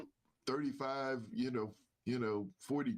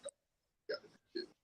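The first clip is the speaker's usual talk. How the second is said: whispered disbelief; 6 dB quieter, much faster than usual. Retold or imitated. Retold reaction, repeated.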